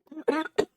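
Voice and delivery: loud real cough; a sharp cough fit. Real, not acted. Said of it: "Sorry, I'm, like, coughing".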